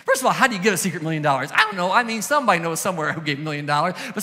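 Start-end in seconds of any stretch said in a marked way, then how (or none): none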